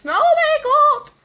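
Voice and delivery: high loud laughing shriek. Real, not acted. Gamer shrieking with laughter at a sudden attack. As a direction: squeal out in shocked laughter.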